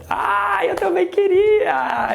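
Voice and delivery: high, drawn-out excited cry; excited squeal. Real, not acted. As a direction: gush like a starstruck fan. Excited wish to meet Will Smith.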